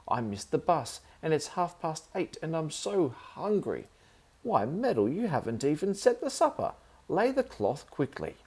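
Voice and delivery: put-on high, fussing old aunt voice; flustered aunt scolding her nephew; high-pitched bossy aunt; fussy aunt.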